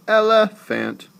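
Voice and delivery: elephant with a high, loud middle syllable; el-LUH-fant, slow, pushing the middle up; wrongly stressed. Shown as a demonstration. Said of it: "You don't say that".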